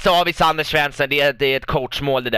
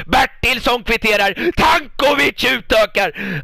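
much higher, loud, slow shout; goal shout; 10 semitones higher, 5 dB louder, slower than usual. Real, not acted. Manager calling the equaliser and the lead goal, loud slow shout. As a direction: yell the scorer's name in triumph. Goals in the live match.

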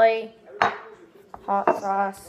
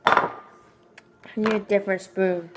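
ordinary delivery

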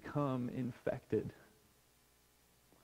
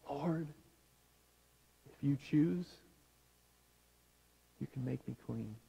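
very soft, halting plea; hushed prayer; 9 dB quieter than usual. Acted. Preacher offering a prayer for today, hushed and pleading. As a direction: pray it quietly, with long pauses between phrases.

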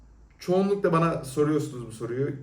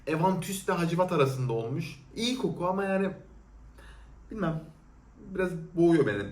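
usual speaking voice

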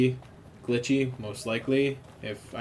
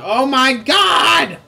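much higher, much louder, long drawn-out shriek of alarm; shocked scream; 13 semitones higher, 16 dB louder, much slower than usual. Real, not acted. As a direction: shriek it slowly as the monster appears. Enemy attacks, sudden panic.

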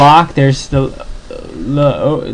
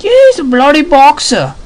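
much higher mock British accent, slowing on the last words; a hammed-up Brit pointing at a fighter; cartoon British voice, pitched high; British bloke; 9 semitones higher than usual. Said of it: "the British other guy's like".

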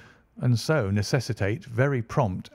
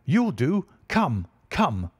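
eager urging; 5 semitones higher, slower than usual. A narrator's reading. Godfrey urging the stranger to the altar, eager. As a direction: hurry the stranger along. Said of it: "he cried".

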